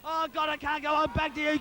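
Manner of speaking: normal talking voice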